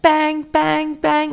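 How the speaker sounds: high, held, chanted cartoon voice; cartoon monster. Little red monster hammering in teeth, high sing-song.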